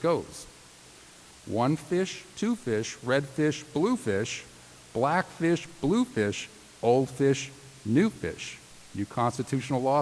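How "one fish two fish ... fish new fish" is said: put-on bouncy, higher children's-book rhythm; sing-song Seuss reading. Reading Dr. Seuss to law graduates, sing-song. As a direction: read it like a bedtime picture book. Reads Dr. Seuss poem aloud.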